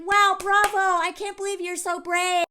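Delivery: high, mock-impressed sarcasm; sarcastic praise. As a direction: applaud him with biting sarcasm. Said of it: "And she's like".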